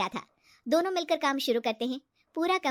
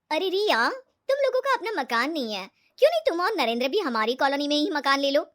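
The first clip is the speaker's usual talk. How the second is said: loud, higher pitched friendly urging; chatty friend Ritu; 3 semitones higher, 5 dB louder than usual. Acted. Ritu urging her friend to buy a house nearby, chatty. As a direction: press the idea eagerly on a friend.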